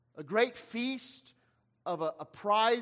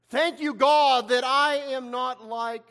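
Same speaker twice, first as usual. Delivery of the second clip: self-righteous Pharisee; 6 dB louder, slower than usual. Acted. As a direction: proclaim your superiority to God, drawn out. Acting the self-righteous Pharisee's prayer.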